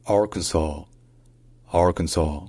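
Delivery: "Arkansas" as AR-kun-saw, stress on AR, final s silent; "Arkansas" with a swallowed kn and no final s; American Arkansas. Shown as a demonstration. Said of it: "ça se dit".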